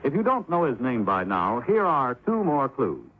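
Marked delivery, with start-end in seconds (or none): none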